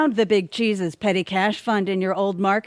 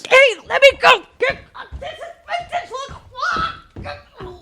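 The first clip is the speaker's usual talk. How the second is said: frantic protest. Acted. Arrested villain protesting at the police. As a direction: squeal as she is hauled off.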